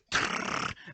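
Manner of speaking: voiceless machine-gun rattle; spray out mouth-made gunfire; rapid-fire gun noise; imitated gunfire.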